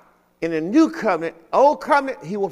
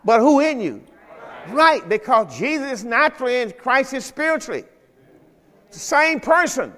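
raised, fervent preacher's cry; preaching at full force; 7 semitones higher, 3 dB louder than usual. Acted. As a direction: call it out to the congregation.

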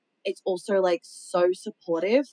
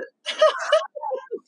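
squealing laugh, much higher than her talk; high burst of laughter; 3 dB louder than usual. Real, not acted. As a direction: let out a shrieking giggle. Laughing at "woman duties" joke.

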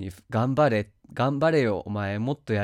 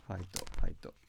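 whispering; 4 semitones lower, 8 dB quieter, faster than usual. Acted. Whispered after the shouts.

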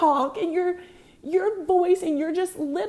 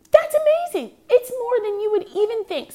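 much higher and louder, then settling; genuinely thrilled; 6 semitones higher, 5 dB louder than usual. Real, not acted. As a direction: burst out with delight. Recalling runners getting encouragement.

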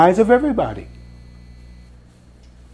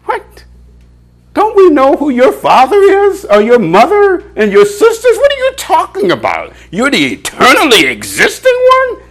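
high, mocking disbelief from the crowd; preacher mimics a scoffing crowd, high and incredulous; 4 dB louder than usual. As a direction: voice the scoffers, pitched way up in outrage. Crowd's incredulous reply to Jesus.

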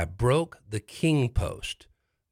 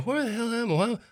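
dazed bewilderment; 13 semitones higher than usual. Retold or imitated. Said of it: "I'm looking around and going".

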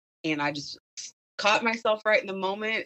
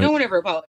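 louder retold statement, no questioning rise; matter-of-fact answer; 2 semitones higher, 5 dB louder, much faster than usual. Student answering the teacher, as a firm statement.

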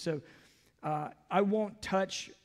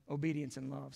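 soft echo; 4 semitones lower, 7 dB quieter, faster than usual. Real, not acted. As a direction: repeat it softer, lower.

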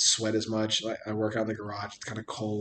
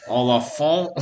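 loud mock French Canadian cry; put-on French Canadian accent, loud; 2 semitones higher, 8 dB louder, slower than usual. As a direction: call out as a French Canadian cartoon figure. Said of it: "his kid was French Canadian".

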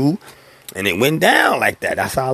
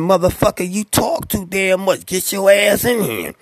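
impatient homeboy; 7 semitones higher than usual. Retold or imitated. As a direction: drag it out, lightly voiced pushy friend. Homeboy urging him in.

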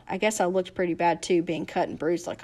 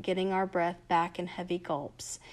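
ordinary delivery

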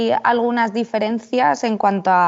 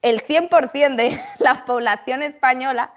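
lightly laughing through a pretend announcement; half-laughing pretend conclusion; amused mock claim; 3 semitones higher than usual. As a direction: make a mock-confident claim with a chuckle. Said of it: "y luego digo".

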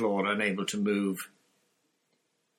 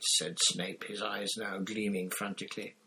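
plain reading voice

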